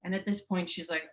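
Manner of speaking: ordinary delivery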